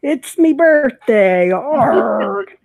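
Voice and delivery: hammed-up pirate, slow and loud. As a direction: growl it like a cartoon pirate. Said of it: "Talk Like a Pirate Day".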